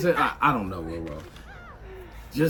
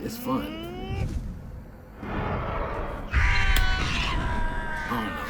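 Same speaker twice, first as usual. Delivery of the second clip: mock high voice; 5 dB quieter, much slower than usual. High mock singsong trailing off.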